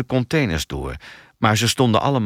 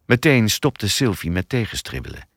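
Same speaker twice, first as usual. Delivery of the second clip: usual speaking voice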